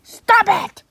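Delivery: high loud yell; shouting. Acted. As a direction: scream at the pet to stop. Yelling at the shark pet.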